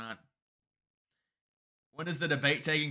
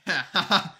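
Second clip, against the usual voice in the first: burst of laughter; 4 semitones higher, 7 dB louder than usual. Real, not acted. Laughing at the poll result.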